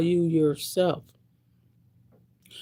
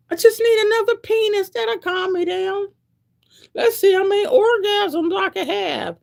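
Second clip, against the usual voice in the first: high squeaky pleading mock voice; mock needy whine; 7 dB louder than usual. To the audience, still mimicking her younger self, high whine. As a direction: keep the whiny younger-self voice going.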